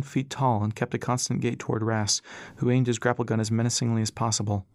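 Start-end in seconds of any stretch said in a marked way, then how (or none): none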